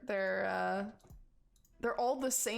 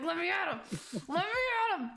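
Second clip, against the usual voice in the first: mock brawler yell; 9 semitones higher, 4 dB louder, much faster than usual. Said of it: "He's like".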